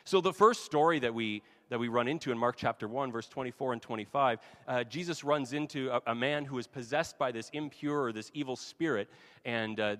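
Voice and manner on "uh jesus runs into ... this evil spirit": usual speaking voice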